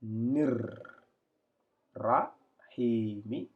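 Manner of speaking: "Nir" with rolled r, then slow "rahimi"; "rahimi" drawn out after a trilled "Nir"; linked reading. Shown as a demonstration. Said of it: "pour faire la liaison".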